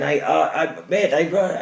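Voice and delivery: high, flustered mock stammer of the driver. Neighbour mimicking the driver he confronted, high mock stammer. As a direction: play the caught-out driver fumbling for words.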